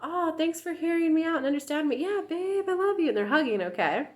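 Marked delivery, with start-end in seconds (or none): 0.0-3.1 high, lightly voiced sweet partner; mock sweet partner. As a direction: coo it back like a doting lover. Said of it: "both people are like".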